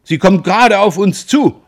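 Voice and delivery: high, loud thrilled cry; excited call. A narrator's reading. Roland telling family the ship approaches, high excited cry. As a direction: tell them it's heading right at us. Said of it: "rief Roland".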